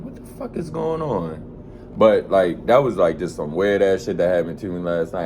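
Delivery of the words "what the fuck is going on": softer, higher, puzzled; understated retold confusion; baffled what the fuck. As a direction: ask it quietly, confused. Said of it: "I just kept saying".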